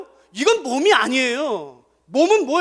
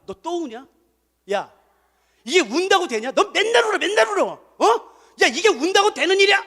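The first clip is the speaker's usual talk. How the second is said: mock rebuke; 7 semitones higher than usual. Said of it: "예언이 말하죠".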